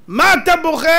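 high, loud challenge; mock rebuke. Preacher taunting the crying Esau, loud mock rebuke. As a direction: throw the question out loudly.

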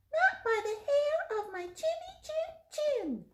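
very high, loud squeak; funny pig voice. Acted. Little pig refusing the wolf, very high squeaky voice. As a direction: squeal defiance like a tiny pig. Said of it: "in a very funny voice".

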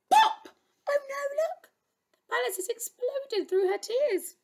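high-pitched, playful alarm; high, exaggerated oh-no reaction; mock dismay. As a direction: gasp at the disaster for small listeners. Reader reacts to exploded palace.

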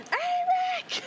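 high, loud call across a distance; excited yell. Acted. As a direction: yell the name out to an old friend. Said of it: "I yell".

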